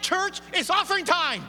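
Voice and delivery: rally the congregation; shouted call. Shown as a demonstration. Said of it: "let's try it out right now".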